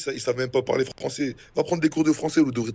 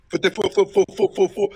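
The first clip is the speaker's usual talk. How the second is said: higher, louder faut faut faut like a skipping record; mock stutter; 6 semitones higher, 4 dB louder than usual. Mocking his friend's stutter as a skipping CD, higher and louder. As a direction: stutter like a scratched CD. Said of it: "ton disque qui est rayé".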